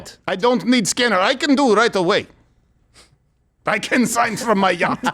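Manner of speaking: eager broken English in a mock Ukrainian accent; Zelensky accent. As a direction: put on a playful Ukrainian accent. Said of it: "I'll do the accent".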